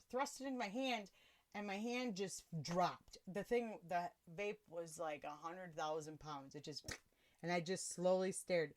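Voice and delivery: normal talking voice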